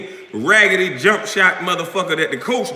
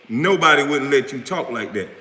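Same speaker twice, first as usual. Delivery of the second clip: usual speaking voice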